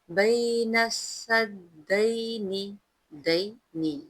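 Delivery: slow chanted verse with long vowels; tajweed recitation. Shown as a demonstration. Teacher reciting the verse for students, slow melodic chant. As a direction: chant each word slowly and melodiously. Demonstrates the phrase.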